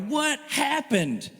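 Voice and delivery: high, drawn-out disbelief; slow, high-pitched astonished question; mock shock. Disciples imitated hearing temple commotion, mock shock.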